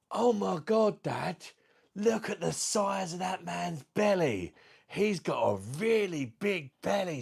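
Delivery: put-on high, slow, amazed child voice. Small child at pool telling dad about belly, high amazed voice. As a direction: blurt it out like a wide-eyed four-year-old.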